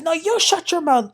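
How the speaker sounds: high, sharp mock yell; mock screaming back. Recapper imitating Larsa yelling at Gertie, mock yell. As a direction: throw it right back at her. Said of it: "Larsa was screaming".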